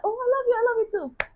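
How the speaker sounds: mock love confession. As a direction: squeak it out like a cheesy couple.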